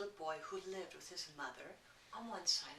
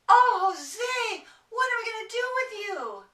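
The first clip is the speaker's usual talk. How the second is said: high loud mock dismay; exasperated mother; 13 semitones higher, 15 dB louder than usual. A narrator's reading. Mother to messy Jose, high loud mock exasperation. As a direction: play the mother despairing at the mess.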